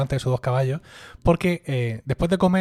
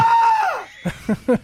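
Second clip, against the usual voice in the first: shrieking laugh. Real, not acted. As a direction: burst out in a shrill laugh. Burst of laughter.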